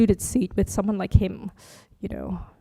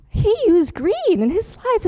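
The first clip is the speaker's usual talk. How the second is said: much higher and slowed with delighted surprise; amazed discovery; 11 semitones higher, slower than usual. Retold or imitated. Teacher retelling spotting the same green in a colleague's slides, high amazed. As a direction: marvel at the coincidence, drawn out.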